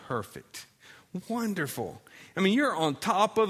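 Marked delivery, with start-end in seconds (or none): none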